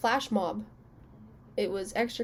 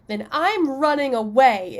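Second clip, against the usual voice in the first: loud, higher bratty declaration; childish huff; 3 semitones higher, 8 dB louder than usual. Retold or imitated. Kid tells dad she's running away, loud bratty huff. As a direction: stomp it out like a sulky ten-year-old.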